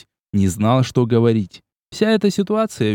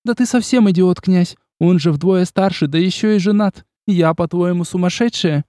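put-on higher, scornful young woman; indignant; 7 semitones higher than usual. A narrator's reading. Olga berating the prince, indignant and higher. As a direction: scold him as an idiot.

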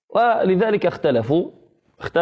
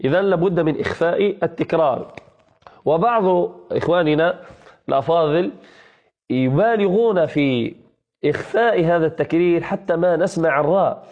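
ordinary talk, nothing marked

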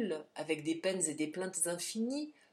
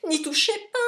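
much higher, much louder cry; panicked shout; 9 semitones higher, 10 dB louder, faster than usual. Acted. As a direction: stop the porter in panic. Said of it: "s'écria Silas".

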